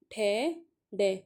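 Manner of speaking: ठै, डै spoken very slowly with the tongue curled back; slow sound demo. Shown as a demonstration. Teacher sounding out the retroflex letters, very slow. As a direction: draw out ठै and डै slowly. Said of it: "अब ये बोल के देखो आप".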